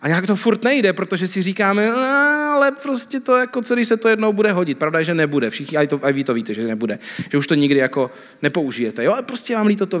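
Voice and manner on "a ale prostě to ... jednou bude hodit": reluctant whine. Acted. Hoarder talking themselves out of throwing things away, reluctant whine. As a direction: plead reluctantly in a raised, dragged-out voice.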